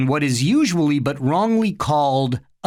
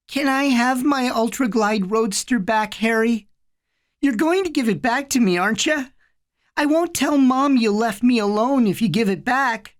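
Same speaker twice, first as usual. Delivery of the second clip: put-on child voice; 9 semitones higher than usual. A narrator's reading. Little brother voiced in story.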